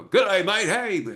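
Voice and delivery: high, loud bad Aussie greeting; butchered Australian accent. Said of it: "then we go down and just butcher the".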